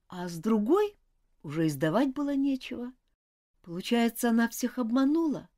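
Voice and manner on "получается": ordinary delivery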